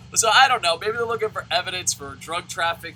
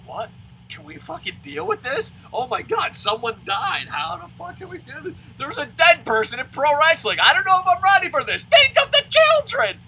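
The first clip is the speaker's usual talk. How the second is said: higher, louder hammed-up shock; mock panic; 12 semitones higher, 9 dB louder than usual. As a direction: play a scandalized fan, rising to a squeal. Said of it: "I'm like".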